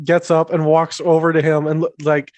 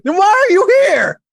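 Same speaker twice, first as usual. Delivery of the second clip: challenging retort; 11 semitones higher, 3 dB louder than usual. Acted. Marvin challenging the noble.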